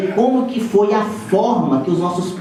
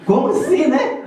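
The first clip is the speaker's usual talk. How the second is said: madre stupita; 10 semitones higher than usual. Retold or imitated. Mother's shocked reaction.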